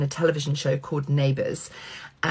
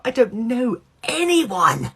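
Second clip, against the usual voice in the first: higher, drawn-out mock dismay; mock panic; 7 semitones higher, 4 dB louder, slower than usual. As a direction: stretch it out in comic alarm. Panicked thought on entering party alone.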